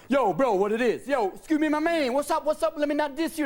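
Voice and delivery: high, loud hammed-up slang; mock streetwise greeting. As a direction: overdo the cool greeting. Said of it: "you go".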